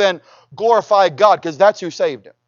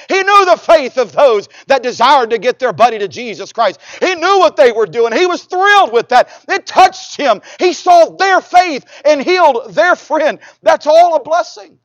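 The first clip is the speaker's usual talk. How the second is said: higher-pitched, building sermon delivery; fervent preaching; 5 semitones higher, 6 dB louder than usual. Real, not acted. Preacher praising the friends' faith to congregation, fervent. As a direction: preach it with mounting conviction.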